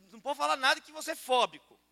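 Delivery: ordinary talk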